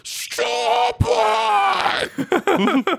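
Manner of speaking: very high, shrieking stop it; Ma's shrieking fit. Acted. Ma spitting out a muffin, shrieking in a high fury. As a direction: shriek it out in disgust. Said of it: "she spits it out and goes".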